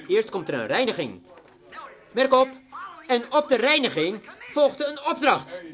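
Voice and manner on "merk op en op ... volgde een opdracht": loud, high preacher's call; ringing, fervent emphasis; preaching at full force. Acted. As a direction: drive the point home from the pulpit. Emphatic sermon high point.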